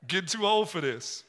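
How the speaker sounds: repeating the line through a laugh. Real, not acted. Preacher laughing as he repeats his dad's words. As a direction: chuckle as you echo the quote.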